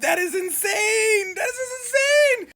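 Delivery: high, drawn-out shriek of disbelief; stunned, slow high-pitched exclamation; shocked outcry. Real, not acted. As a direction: stretch it out in a piercing cry. Host exclaims in shock at story.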